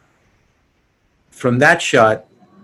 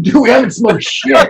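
laughing mock protest; 7 semitones higher, 3 dB louder than usual. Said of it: "They're like".

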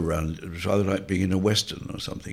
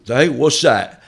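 higher, loud mock bafflement; loud uncomprehending query; 7 semitones higher, 13 dB louder, slower than usual. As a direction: blurt it out as a clueless listener. Dim listener in retold exchange.

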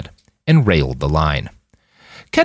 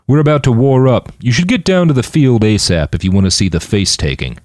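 raised-voice announcement; calling out; 5 semitones higher, 4 dB louder than usual. A narrator's reading. Mike summoning the group to the field, calling out. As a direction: open loud and high, then ease slightly. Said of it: "he called out".

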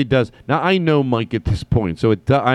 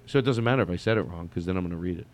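ordinary talk, nothing marked

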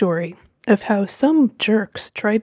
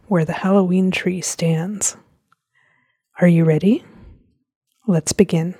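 spooky whisper; 2 semitones lower than usual. Acted. Spooky intro before the reading.

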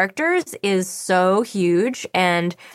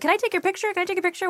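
much higher, soft squeaky fan plea; eager fan voice; 12 semitones higher, 4 dB quieter, much faster than usual. Retold or imitated. Fans at a con asking cosplayers, high eager squeak. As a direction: chirp it like an excited fan at a con. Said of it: "People are like".

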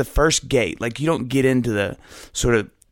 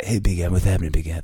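a light, lower Cable Guy impression; lightly voiced cable guy greeting, pitched lower; 5 semitones lower, faster than usual. To his closest friend, a lightly voiced cable guy greeting. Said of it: "we do the cable guy".

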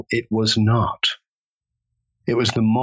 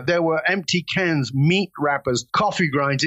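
usual speaking voice

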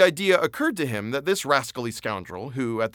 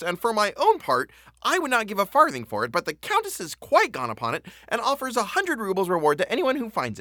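a bright, chatty lackey making small talk, pitched higher; lively chatty lackey; 6 semitones higher than usual. A narrator's reading. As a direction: gossip sociably to the official. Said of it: "speaking in a lively tone by way of showing himself sociable".

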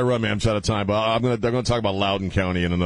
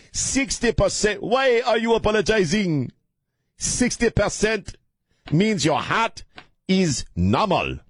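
put-on high, slow, emphatic African-accented doctor; mock African accent; 12 semitones higher, slower than usual. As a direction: play an incredulous cardiologist with a heavy African accent. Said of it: "do a very racist accent".

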